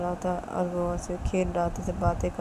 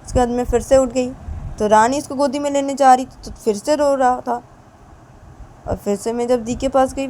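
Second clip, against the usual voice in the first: louder, higher, heated nagging complaint; loud complaining rant; 6 semitones higher, 8 dB louder than usual. Acted. Wife rants loudly to husband about the crying baby. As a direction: list every grievance in a heated rush.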